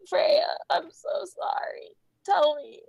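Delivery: sobbed pleading, dropping into whispers; desperate, tearful entreaty; crying apology. Acted. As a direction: beg forgiveness through tears. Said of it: "He continues to cry".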